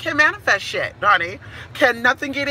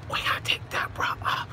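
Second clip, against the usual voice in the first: whispered song line; 10 dB quieter than usual. Shown as a demonstration. Host whispers the [name] lyric to viewers. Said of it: "The Whisper song".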